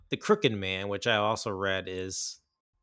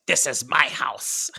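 loud, slow, raised movie voice; mock the possessed voice, loud and drawn out; imitated possessed-girl voice; 4 semitones higher, 8 dB louder, slower than usual. Girl starts talking in the movie, imitated possessed voice. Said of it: "like, the voice".

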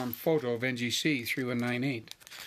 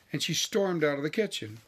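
ordinary delivery, nothing marked

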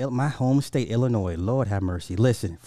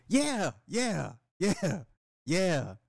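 high, softer British "yeah"; mock British accent; 8 semitones higher, 5 dB quieter, much slower than usual. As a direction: keep up the pretend Brit, easing down. Still playing the mock Brit.

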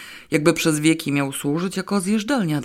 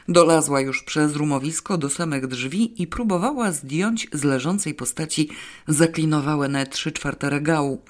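ordinary delivery

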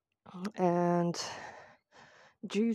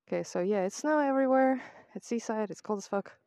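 ordinary talk

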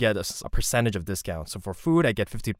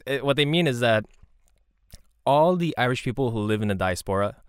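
usual speaking voice

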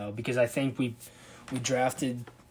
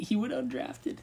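incredulous; 12 semitones higher, 5 dB quieter, slower than usual. Real, not acted. Disbelief at the draft news.

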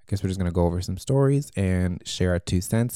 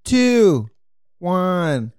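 high, loud countdown cheer; new year countdown; 12 semitones higher, 7 dB louder, much slower than usual. Pretend. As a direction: shout the countdown like midnight.

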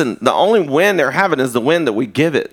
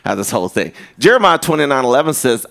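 ordinary delivery

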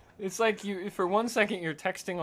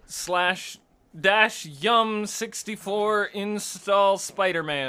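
slow mock command; 3 semitones higher, 5 dB louder, much slower than usual. Mock command-line reading.

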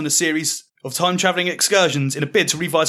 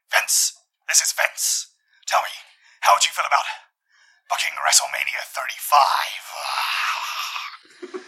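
Vince McMahon voice; slower than usual. Acted. Voicing Vince McMahon on phone.

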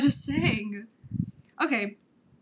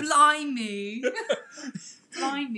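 giggly mock British exclamation; hammed-up British; British accent; 3 semitones higher, much slower than usual. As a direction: exclaim in a fake British accent, breaking into laughter. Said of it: "Let us hear your British accent".

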